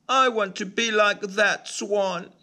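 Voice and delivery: a little bird listing who rejects him; tiny-voiced duckling, hurt and alone; sad ugly duckling. A narrator's reading. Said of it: "he says".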